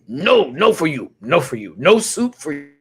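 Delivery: loud, high-pitched denial; hammed-up scolding rebuff; mock refusal. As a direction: refuse emphatically, punching each no. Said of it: "and then it's like".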